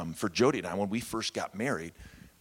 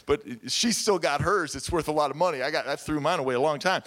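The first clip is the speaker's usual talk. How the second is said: amused protest; 8 semitones higher, 7 dB louder than usual. Real, not acted. Joking over watch after laughing.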